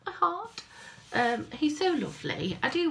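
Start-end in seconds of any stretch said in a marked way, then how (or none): 0.0-0.6 a swooning cry of delight; melting. Retold or imitated. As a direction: gush it, heart melting. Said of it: "I was like".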